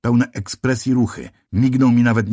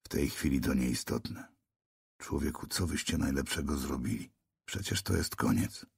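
much softer and lower, drained; tormented, dull voice; 7 semitones lower, 14 dB quieter than usual. A narrator's reading. Narrator tells the policeman it's the end, drained and soft. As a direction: barely lift the words, hopeless. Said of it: "równie udręczonym, matowym głosem".

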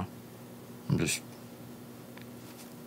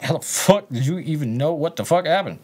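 higher-pitched, indignant retold outburst; incredulous demand; 6 semitones higher than usual. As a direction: act out your stunned reply on the phone. Retelling his stunned reply on call.

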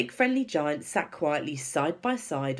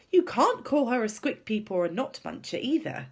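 high, cross protest; indignant, pitched-up objection; getting angrier; 10 semitones higher, 4 dB louder than usual. A narrator's reading. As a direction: scold with rising indignation. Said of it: "getting angrier and angrier".

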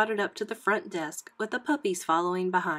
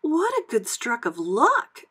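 put-on higher, louder, delighted young pup; puppy Annette; 4 semitones higher, 6 dB louder than usual. A narrator's reading. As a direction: draw out the happy surprise. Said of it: "remarked Annette".

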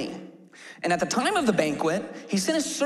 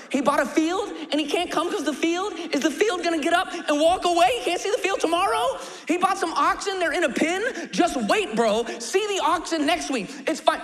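higher, scoffing ridicule of the excuses; mocking Pharisees; 8 semitones higher than usual. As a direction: laugh at how stupid the excuses are. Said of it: "I can imagine the Pharisees".

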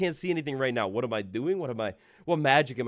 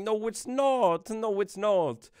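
slow drawn-out denial; 9 semitones higher, much slower than usual. Pretend. Arguing with his own computer.

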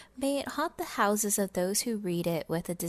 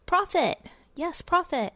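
loud, high cry of greed; gleeful exclamation; 8 semitones higher, 3 dB louder than usual. Acted. Miser crowing about profit, loud and gleeful. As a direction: shout it like counting gold. Miser gloating over money.

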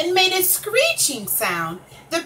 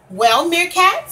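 slow, stern elephant calling him out; a stern demand, drawn out; 5 semitones higher, 5 dB louder than usual. A narrator's reading. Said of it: "said the elephant sternly".

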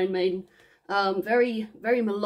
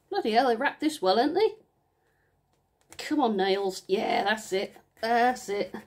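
ordinary talk, nothing marked

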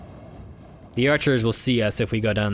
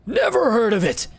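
put-on old beggar voice; 8 semitones higher than usual.

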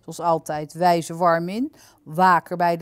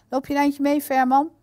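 raised, higher and louder invitation; calling out; 8 semitones higher, 6 dB louder than usual. A narrator's reading. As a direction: call over to someone to come along. Character calls Verman along. (roepend, uitnodigend)

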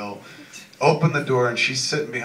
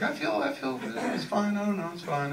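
nervous wife voice; 11 semitones higher, 4 dB quieter than usual. Retold or imitated. Wife voiced in stoned story.

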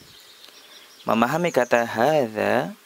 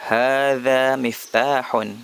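slow, careful Arabic, hadza stressed; slow model phrase; slower than usual. Shown as a demonstration. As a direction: recite it slowly for pupils to repeat. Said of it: "Hadza, ini".

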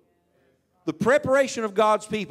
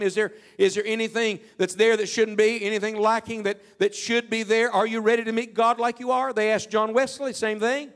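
full-force preaching; 5 semitones higher than usual. Acted. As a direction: press the congregation, building to the question. Preacher presses the altar call.

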